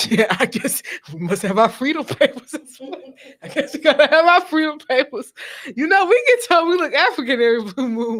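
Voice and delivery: amused, talking through laughter; real laughter breaking into the words; laughing. Real, not acted. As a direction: joke while cracking up. Joking about needing freedom papers.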